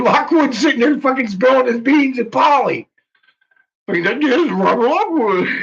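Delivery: high-pitched, incredulous laughing talk; cracking up. Real, not acted. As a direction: tell it through real, helpless laughter. Cracking up recounting the post.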